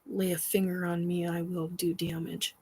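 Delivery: quiet menace to the unseen. Real, not acted. Threatening unseen entities.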